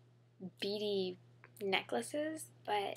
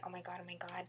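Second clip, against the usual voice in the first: whispered; 4 semitones lower, 9 dB quieter, much faster than usual. Retold or imitated. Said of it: "that I say".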